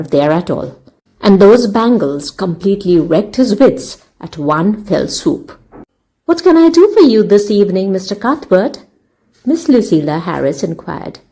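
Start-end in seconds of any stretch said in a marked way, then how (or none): 6.2-8.8 quick, eager shopgirl charm, a bit louder and higher; brisk and ingratiating. A narrator's reading. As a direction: rush it out sweetly to please the customer. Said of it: "briskly and ingratiatingly".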